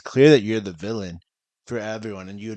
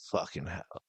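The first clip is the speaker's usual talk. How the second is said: scheming mutter; 5 semitones lower, 9 dB quieter than usual. Retold or imitated. Mock Verizon manager scheming.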